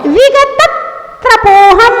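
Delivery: विगत cut on a held t, then त्रपोहम; stop held at word end. Shown as a demonstration. Teacher models correct recitation, halting after विगत before त्रपोहम. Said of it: "उसी मुद्रा में रुक जाइए".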